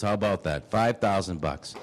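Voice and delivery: normal talking voice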